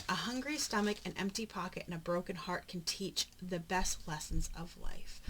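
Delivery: ordinary talk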